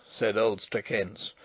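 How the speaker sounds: plain reading voice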